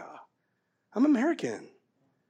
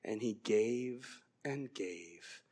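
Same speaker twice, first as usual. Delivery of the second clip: very low, slow, fading to a whisper; solemn near-whisper; hushed reverence; 9 semitones lower, 12 dB quieter, slower than usual. Real, not acted. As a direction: let each phrase sink softer, ending whispered. Solemn climax on self-giving.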